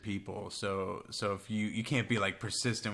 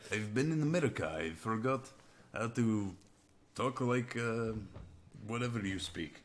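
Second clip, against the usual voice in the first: halting mock Russian accent; 2 semitones higher than usual. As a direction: grope for words in fake Russian.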